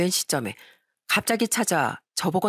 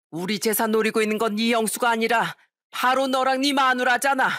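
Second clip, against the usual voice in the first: mother shouting, pitch raised high; angry shout; 4 semitones higher than usual. Acted. Mother shouting her son and daughter-in-law out of the house. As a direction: drive them out with rising fury.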